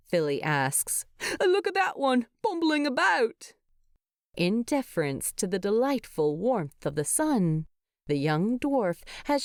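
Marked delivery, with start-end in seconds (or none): 1.4-3.5 delighted. A narrator's reading. Fili to Bilbo about the bees, higher delighted voice. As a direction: point it out with glee.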